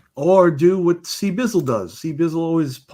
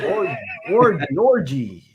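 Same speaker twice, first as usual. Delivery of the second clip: Italian accent; 2 semitones higher than usual. Shown as a demonstration. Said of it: "I can do a terrible Italian accent too".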